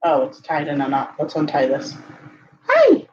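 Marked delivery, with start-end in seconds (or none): 2.7-3.1 sudden very high, loud hi; cooing hi. Real, not acted. Owner greeting her cat, very high and loud. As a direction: greet the kitty with a high squeal.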